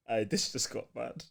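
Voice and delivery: ordinary talk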